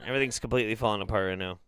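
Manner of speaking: normal talking voice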